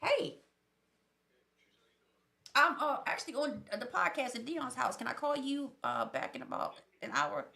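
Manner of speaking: hushed phone call; quick quiet call, putting them off; dodging a caller. Real, not acted. Answers phone mid-podcast.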